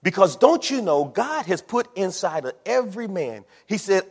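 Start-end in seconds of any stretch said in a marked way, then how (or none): none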